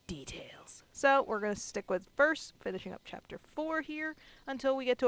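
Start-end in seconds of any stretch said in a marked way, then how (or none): 0.1-0.8 whisper. Acted. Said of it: "I wanna know all the".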